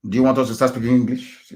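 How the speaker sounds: ordinary delivery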